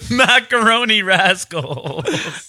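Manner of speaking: slow amused drawl. Real, not acted. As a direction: savor each word, slow and high. Savoring the funny name.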